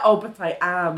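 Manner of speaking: mock insistence. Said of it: "she's like".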